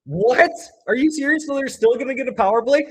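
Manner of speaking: high, loud incredulous questions; can't believe the call; outraged disbelief. Real, not acted.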